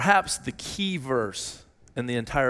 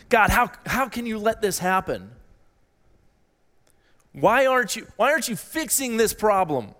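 higher-pitched, imploring questions to God; questioning and doubting; 6 semitones higher than usual. Acted. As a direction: voice the prophet challenging God, pitched up. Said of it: "He was questioning and doubting God".

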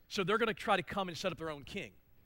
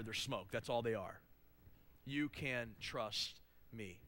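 quiet reassurance; 9 semitones lower, 10 dB quieter than usual. Real, not acted. Quiet reassurance after loud passage.

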